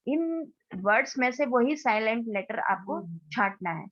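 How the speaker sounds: usual speaking voice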